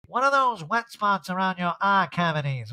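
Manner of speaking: Erica. Retold or imitated. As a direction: imitate someone baffled by feelings, drawn out. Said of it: "And Erica's like".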